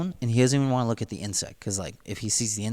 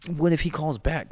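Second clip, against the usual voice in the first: higher-pitched retold panic; mock bugging out; 6 semitones higher than usual. Man worrying his friend will ring back, mock panic. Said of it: "then he starts bugging".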